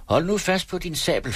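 high-pitched taunting order; commanding warning. Acted. Taunting warning during sword fight. (udfordrende advarsel i højt toneleje)

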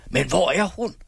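high, furious narrated cry; raging outburst, pitched well up; screamed question. A narrator's reading. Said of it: "skreg min onkel".

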